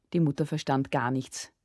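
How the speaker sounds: ordinary delivery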